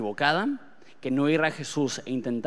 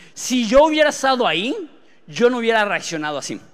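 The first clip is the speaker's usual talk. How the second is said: higher, louder cocky boast; teen bravado; 6 semitones higher, 7 dB louder than usual. Retold or imitated. Preacher mocking his teenage self, cocky and loud. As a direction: brag like a proud teenager sure he'd do better. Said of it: "Ese es el orgullo de un adolescente".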